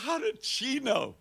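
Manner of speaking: high, stunned gasp of disbelief; shocked rishi. Acted. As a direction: rishi caught off guard, asking in shock.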